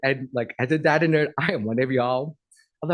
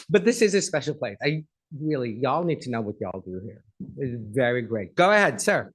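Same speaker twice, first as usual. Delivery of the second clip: usual speaking voice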